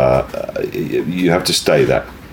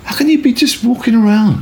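put-on much higher, incredulous young lad; puzzled kid who can't believe it; baffled kid; 13 semitones higher, faster than usual. Street kid asks why he walks around, high baffled mimicry. Retelling kid's baffled question.

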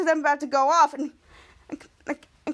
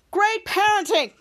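slow, higher-pitched mock praise; sarcastic; 6 semitones higher, slower than usual. Henry to his parents as they leave, sarcastic. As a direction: stretch out the sarcasm. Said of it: "him being sarcastic".

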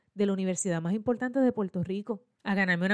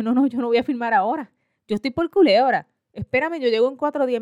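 loud, insistent retold protest; emphatic refusal; 3 semitones higher, 7 dB louder than usual. Applicant on the phone refusing to sign now, emphatic. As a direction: push back firmly, voice raised.

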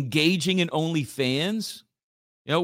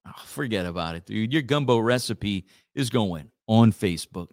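dismissive taunt; 7 semitones lower than usual. Acted. Acted spiteful ex.

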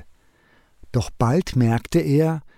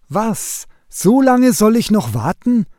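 higher, louder shocked outburst; dismayed surprise; 7 semitones higher, 3 dB louder than usual. A narrator's reading. Nightingale shocked at God's answer, dismayed surprise. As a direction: gasp out the disbelief.

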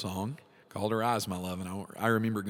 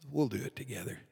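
a touch of soft, gentle promise; quietly reassuring, face in His hand; tender reassurance; 3 semitones higher, 4 dB quieter, faster than usual. Acted. The Lord comforting his hesitant servant, softly reassuring. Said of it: "He says".

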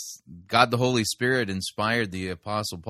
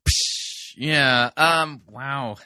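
usual speaking voice

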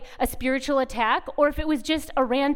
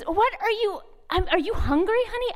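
baffled mother; 8 semitones higher than usual. Retold or imitated. Mother baffled again by toddler.

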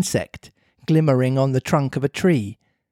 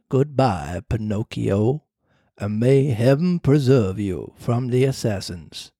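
low, slow ghostly cricket; slow low voice of a spirit; talking cricket voice; 4 semitones lower than usual. Acted. The cricket's farewell.

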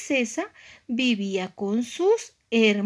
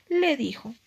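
usual speaking voice

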